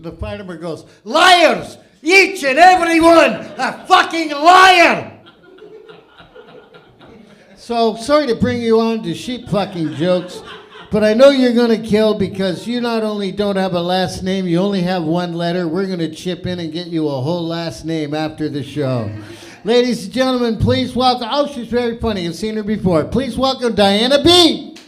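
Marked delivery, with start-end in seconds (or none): 1.0-5.2 high, very loud indignant yell; explosive comic punchline shout; outraged farmer's shout. Acted. Farmer in joke yells accusation. 19.6-24.8 loud, raised-pitch stage announcement; rousing host's welcome, building on the name; emcee introduction. Real, not acted. Host brings on next comedian.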